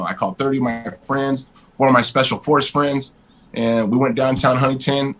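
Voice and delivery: ordinary talk, nothing marked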